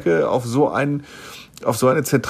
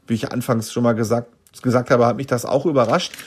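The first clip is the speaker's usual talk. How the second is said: normal talking voice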